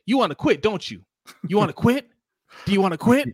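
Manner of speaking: pushy team bosses. Retold or imitated. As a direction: keep pressing him to quit, mock-sweet. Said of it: "They're like".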